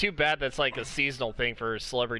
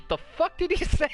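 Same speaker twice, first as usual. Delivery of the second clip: high, laughing disbelief; mimicking Steve Harvey's shocked outburst; 4 dB louder than usual. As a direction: blurt it out in stunned disbelief. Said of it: "just looks at the audience and just says".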